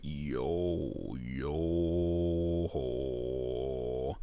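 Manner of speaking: low, quiet creaky fry; vocal fry. Shown as a demonstration. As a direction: drop into a low gravelly fry. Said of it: "you can put a little chord into it".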